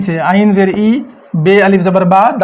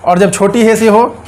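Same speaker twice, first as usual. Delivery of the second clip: ordinary talk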